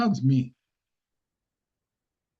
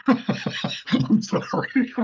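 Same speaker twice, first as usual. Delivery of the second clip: breathy high laugh into "I'm sorry", slow; real laugh spilling into a slow "I'm sorry"; laughing apology; 9 semitones higher, much slower than usual. Real, not acted. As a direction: giggle out an apology. Cracks up at own joke.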